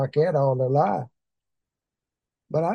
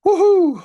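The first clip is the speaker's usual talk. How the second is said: high, loud celebratory whoop; joyful whoop; 8 dB louder than usual. Real, not acted. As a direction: let out a happy whoop. Celebrating in praise.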